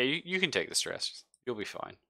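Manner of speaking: usual speaking voice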